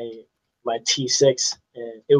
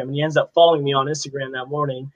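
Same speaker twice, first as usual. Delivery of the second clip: ordinary delivery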